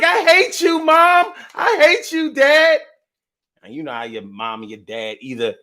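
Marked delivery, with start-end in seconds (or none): 0.0-2.8 put-on high, loud childish whine; mock a bratty kid yelling at parents; whiny kid. Kid whining at mom and dad, high childish whine. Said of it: "kids, you be like".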